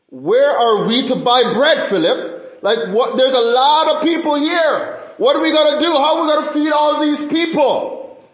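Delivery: teasing, high-pitched urgent appeal; mock-urgent Jesus. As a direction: play up the alarm to tease someone, pitch climbing. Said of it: "He was pulling his leg".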